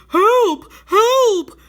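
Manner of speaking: high, loud cries for help; shouting for help. A narrator's reading. Bear clinging to a branch in the flood, loud high shouts. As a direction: yell it out, desperate.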